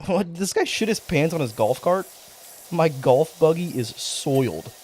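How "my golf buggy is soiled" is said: slow, deliberate, a bit louder; mock-solemn repeat. Streamer repeating the game line to chat, slow and mock-grave. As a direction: savour every word like a grave announcement.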